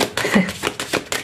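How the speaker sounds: soft, breath-only laugh; quiet chuckle. Real, not acted. Reader amused by her own reading, hushed chuckle.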